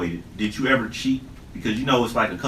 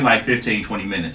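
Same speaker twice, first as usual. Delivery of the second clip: usual speaking voice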